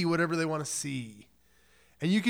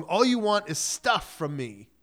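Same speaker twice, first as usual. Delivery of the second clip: ordinary talk, nothing marked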